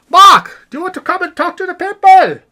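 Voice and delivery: loud, high-pitched holler; calling out. Acted. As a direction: shout for a friend in another room. Calling Mark from elsewhere.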